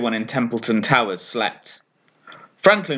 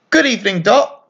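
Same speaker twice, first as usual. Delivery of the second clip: bright little bat voice, pitched up and a bit louder; chirpy; 8 semitones higher, 4 dB louder than usual. A narrator's reading. Little bat greeting his witch friend, chirpy and high. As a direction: greet her cheerfully like an eager little bat. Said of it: "Franklin said chirpily".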